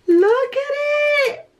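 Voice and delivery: gasp, then high loud squeal; real high-pitched amazement; thrilled squeal. Real, not acted. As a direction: squeal with delight at first sight. First sight of the notebook.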